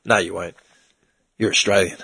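low, hushed hero line with heavy pauses; stoic war veteran. Retold or imitated. Veteran steadying a kid who expects to die, stoic and low.

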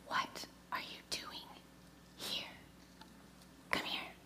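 a soft breathy whisper as God; God whispering to Elijah in the cave; gentle whisper. Retold or imitated. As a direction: whisper it close and tender, drawing him out. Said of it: "came a gentle whisper".

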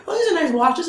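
high falsetto lady voice; imitating a lady customer in falsetto. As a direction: squeak it out like a fussy shopper. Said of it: "this lady comes up and goes".

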